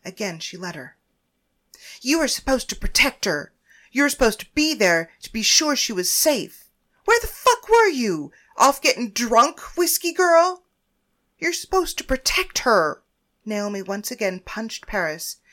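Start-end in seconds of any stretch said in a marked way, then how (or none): 2.0-13.0 rising, louder furious blame; angry accusation. Acted. Naomi beating Paris for failing to protect her, angry.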